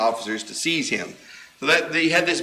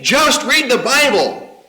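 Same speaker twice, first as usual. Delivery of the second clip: urgent plea; 9 semitones higher, 6 dB louder, slower than usual. Acted. Preacher urging congregation.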